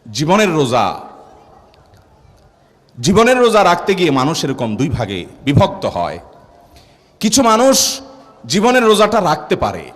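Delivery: usual speaking voice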